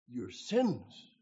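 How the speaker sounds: loud emphatic your sins; preacher's punchline. Retold or imitated. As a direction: hit it hard like a verdict. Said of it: "first topic of conversation".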